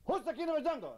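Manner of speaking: squeaky high bully of a lion, demanding; bossy lion. Acted. Nasty lion demanding an answer from the animals, in a high squeaky bark. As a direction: play the lion as a pint-sized tyrant.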